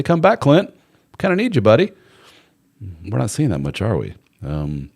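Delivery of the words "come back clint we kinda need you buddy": high, mock pleading come back; society calling a lonely man back, high and coaxing; coaxing welcome. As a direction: call him back in like a pal. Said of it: "society overall would say".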